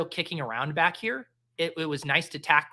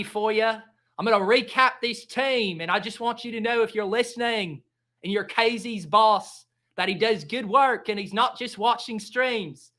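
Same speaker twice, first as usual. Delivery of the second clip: put-on light Aussie accent, a bit higher; mock Australian accent; 4 semitones higher, 3 dB louder than usual. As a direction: play a jokey Australian reading the roster. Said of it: "I have to anchor myself with this accent".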